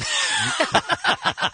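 shrill giggling squeal; high squeal laugh. Real, not acted. Laughs at headline.

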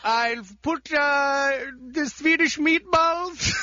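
put-on hesitant comic Swedish accent. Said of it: "Johnny Sweden".

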